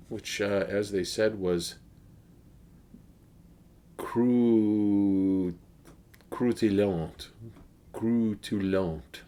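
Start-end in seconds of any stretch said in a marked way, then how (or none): 4.0-7.3 croustillant said in a French accent, nasal French vowels. Shown as a demonstration. A beer reviewer tries the French word in a French accent. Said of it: "I'm doing it in a French accent". 7.9-9.2 Croutillante drawn out very slowly in a French accent, pitch falling at the end. Shown as a demonstration. A beer reviewer slowly tries the French word for crisp in a French accent. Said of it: "I'm doing it in a French accent".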